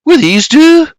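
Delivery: high, loud woman's voice; Margaret's urgent question. A narrator's reading. Margaret calls, offering old dresses.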